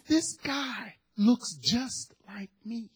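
slow, drawn-out whisper; hushed revelation. Retold or imitated. Son tells dad of his lookalike, hushed revelation. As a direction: whisper it slowly, letting the shock sink in. Son confides startling news to father.

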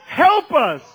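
loud, high cry for help; emphatic plea. Real, not acted. Preacher pleads to the Lord for Christians, loud and high. Plea repeated with rising force.

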